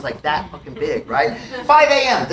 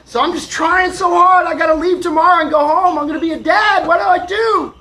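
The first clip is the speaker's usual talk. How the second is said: high, loud desperate outpouring; frustrated; 10 semitones higher, 6 dB louder than usual. Retold or imitated. Soon-to-be dad pleading with the sky, acted frustrated yelling. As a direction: plead at the top of the voice. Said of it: "I was getting so frustrated".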